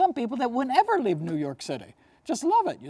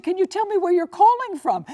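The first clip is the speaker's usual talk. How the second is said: raised, lively question; higher excited voice; 11 semitones higher, 6 dB louder than usual. Retold or imitated. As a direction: ask it up high and bright. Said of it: "Oprah said".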